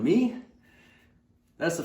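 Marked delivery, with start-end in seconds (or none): 0.0-0.4 high, loud questioning disbelief; dumbfounded. Retold or imitated. Boy hears coach single him out, astonished high question. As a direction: re-enact a kid stunned to be singled out. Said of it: "I'm kind of dumbfounded".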